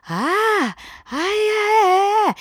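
high, strained cries of pain. Retold or imitated. Mom crying out in pain from the bathroom, high and strained. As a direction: cry out in pain from the next room.

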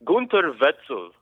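Gunther Wetzel said the German way; German accent on the name Gunther Wetzel. Acted. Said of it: "I like your German accent".

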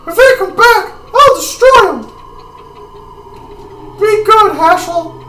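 very high, loud character voice; high-pitched game character. Acted. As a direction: pitch it way up and call it out.

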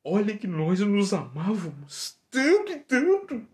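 high, distressed female voice; high woman's voice. Acted. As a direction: play the abandoned woman, high. Same woman character.

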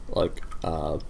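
usual speaking voice

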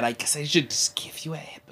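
hushed, whispered mock reluctance; soft, voiceless grudging offer; awkward dad whisper. Zeus voiced as awkward new dad.

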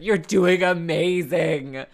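loud, slow call out of a car window; a loud slow shout of encouragement to a stressed driver; encouraging shout. Retold or imitated. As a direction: cheer her on through the window. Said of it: "I just shouted".

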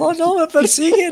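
high-pitched hammed-up fright; mock panic. Acting out a tourist chased in Mad Max, hammed-up panic. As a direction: play a tourist being chased, squealing.

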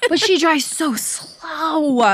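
high, slow, stretched-out whine; exasperated drawn-out complaint. Real, not acted. Daughter complaining about mom's slow driving, exasperated. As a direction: drag out the words in exasperation.

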